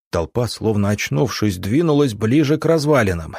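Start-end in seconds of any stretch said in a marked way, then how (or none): none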